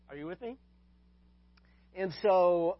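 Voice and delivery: ordinary talk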